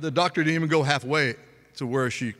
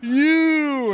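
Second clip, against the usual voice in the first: slow start climbing to a much higher, louder yell; cussing in labor; 3 semitones higher, 5 dB louder, much slower than usual. Retold or imitated. Woman next door in full labor, building furious yell. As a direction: build from a growl to a furious scream. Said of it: "She would let every cuss word out there".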